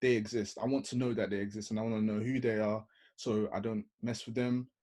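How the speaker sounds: ordinary delivery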